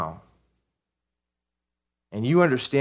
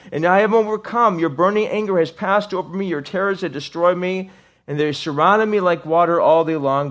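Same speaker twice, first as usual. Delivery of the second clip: loud lament; 7 semitones higher, 6 dB louder than usual. Acted. Reads psalm of God's anger.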